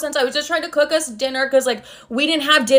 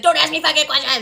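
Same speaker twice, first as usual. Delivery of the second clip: mock dad outburst in a put-on, raised voice; imitated furious father; 4 semitones higher than usual. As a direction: snap it as an exploding dad, pitched up. Voices father exploding at her.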